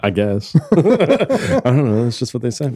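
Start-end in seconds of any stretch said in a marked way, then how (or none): 0.7-2.0 laughing. Real, not acted. Laughing embarrassed.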